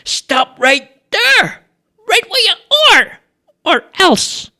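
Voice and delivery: squeaky high-pitched shout, drawn out; a jumpy young guard yelling Stop; shouted high challenge. A narrator's reading. Charlie challenges a stranger at the barricade, high squeaky shout. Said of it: "and he shouted".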